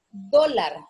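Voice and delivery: teacher's loud stress on DÓ in "Dólar"; stress on first syllable. Shown as a demonstration. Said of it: "tiene la sílaba tónica en do".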